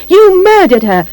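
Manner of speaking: high shouted accusation. A narrator's reading. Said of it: "Missus Carp shouts".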